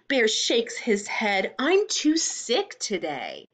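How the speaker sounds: slow, sing-song storybook reading; reading to children. A narrator's reading. Teacher reading a picture book to the class. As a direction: read it slowly to little ones.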